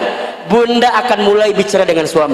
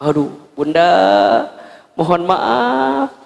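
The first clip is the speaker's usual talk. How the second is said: minta maaf memelas; slower than usual. Acted. Caught husband apologizes.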